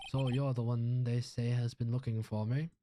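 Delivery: put-on Southern drawl; grand lawyer in a Southern accent. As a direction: play it as a pompous Southern gentleman. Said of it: "giving everybody southern accents".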